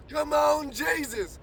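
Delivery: ordinary talk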